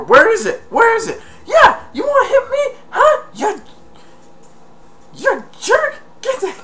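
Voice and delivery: high, loud cartoon yelling; mock angry burglar. Burglar yelling at his partner, high mock shout. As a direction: shout it like a furious crook.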